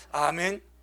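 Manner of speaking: ordinary talk, nothing marked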